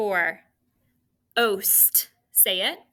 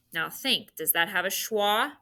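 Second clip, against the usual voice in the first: ordinary delivery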